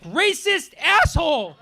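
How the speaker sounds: high shrill-free hammed-up yell of outrage; mock outraged heckler yelling; mock angry yell. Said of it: "she was like".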